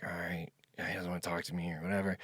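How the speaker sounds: soft, low aside to self; resigned muttering. Retold or imitated. Traveler ignored by a farmer, resigned muttering. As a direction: drop it low, as if giving up.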